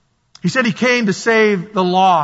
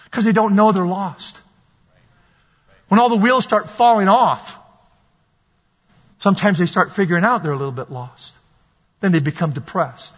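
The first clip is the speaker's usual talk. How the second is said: ordinary talk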